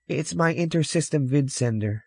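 put-on high child's voice; little boy. Boy explaining his box to a stranger, high child voice. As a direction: pipe it up like a small boy.

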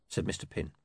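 plain reading voice

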